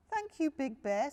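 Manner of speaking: high, small cub voice, grateful; Little Bear. A narrator's reading. Cub thanking dad for the lantern, high and sweet. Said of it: "said Little Bear".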